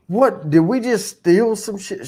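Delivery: high-pitched mock alarm; retold incredulous question. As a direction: re-enact your shocked realization. Acts out his alarmed question.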